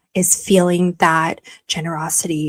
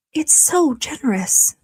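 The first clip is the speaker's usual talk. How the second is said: usual speaking voice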